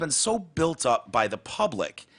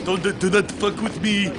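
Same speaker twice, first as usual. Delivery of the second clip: put-on Arabic accent; 5 semitones higher than usual.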